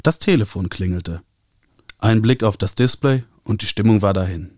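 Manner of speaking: plain reading voice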